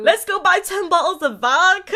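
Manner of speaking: laughing while speaking, high and loud. Real, not acted. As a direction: giggle through the words. Joking plan, laughing.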